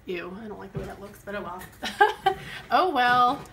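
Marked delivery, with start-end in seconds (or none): 1.2-3.4 laughing resignation. Real, not acted. Shrugging off messy lettering.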